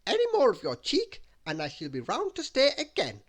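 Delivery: high, loud bossy warning; overbearing character voice; Little Miss Bossy. A narrator's reading. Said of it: "said Little Miss Bossy".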